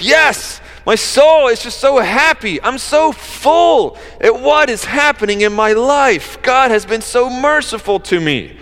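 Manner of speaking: overjoyed burst of praise; excited. Shown as a demonstration. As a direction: gush it like a thrilled teenager. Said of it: "she's excited".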